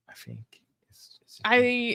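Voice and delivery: soft unvoiced aside; whisper. Real, not acted. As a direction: whisper it half to yourself.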